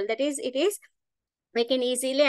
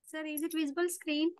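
much softer, faint question; barely audible; 3 semitones higher, 9 dB quieter than usual. Real, not acted. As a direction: ask faintly, too soft to catch. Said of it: "Your voice is very low".

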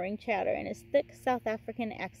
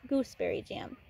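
ordinary delivery, nothing marked

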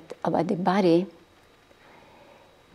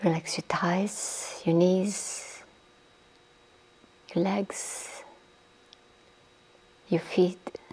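guided meditation. Acted. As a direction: ease the listeners down into stillness, breathy and unhurried. Leads a guided body-relaxation meditation.

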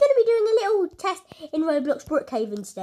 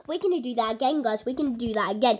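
ordinary talk, nothing marked

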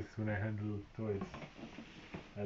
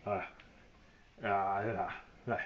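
mock fighting grunts; slower than usual. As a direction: grunt as if throwing punches. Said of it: "He's like".

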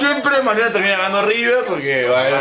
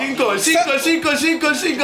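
breakneck goal commentary; 9 semitones higher than usual. Real, not acted. Frantic call during goal chance.

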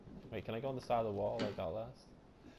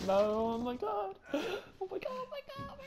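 climbing, very high squeal fading off; rising panic. Real, not acted. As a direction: squeal higher and higher in fear.

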